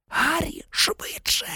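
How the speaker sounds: high, hushed urging; urgent whisper. A narrator's reading. Hermione hurrying Harry from behind a tree, urgent whisper. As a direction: whisper it like a frantic warning.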